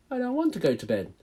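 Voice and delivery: put-on high, fast baby owl protest; a little owl refusing bed; child owl complaining; whiny owlet. Plop protests bedtime.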